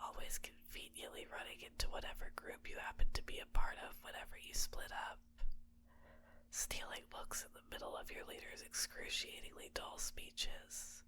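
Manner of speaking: very soft, intimate whisper; whispered confession. Acted. Captor confessing secret feelings to captive, in a hushed whisper. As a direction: confess it under your breath, fully whispered.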